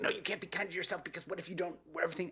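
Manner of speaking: high, fast, fretful inner voice; nagging inner critic. Acted. The speaker's own anxious brain arguing back, high and rushed. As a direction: rattle it off like a worried inner critic.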